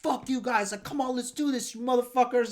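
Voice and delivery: high, heated challenge to fight; full gangster. Retold or imitated. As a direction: re-enact a riled-up call-out. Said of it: "full gangster on them".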